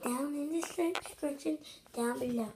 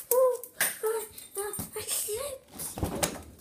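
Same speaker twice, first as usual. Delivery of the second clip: startled; 8 semitones higher, 4 dB louder, slower than usual. Real, not acted. Slips in the water.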